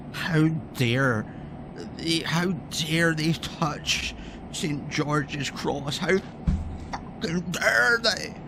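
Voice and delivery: slow, hammed-up indignation; mock outrage. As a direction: ham up the patriot's shock. Mocking outraged patriots.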